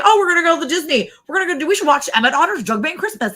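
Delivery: high, fast mock-cheerful parents. Mocking parents announcing a Christmas movie, high and fast. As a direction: chirp it fast like eager parents. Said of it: "They were like".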